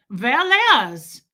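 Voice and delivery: put-on loud German-accented command; forceful, curt German accent; terrifying German accent. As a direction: imitate a stern German woman giving an order. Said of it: "she was terrifying".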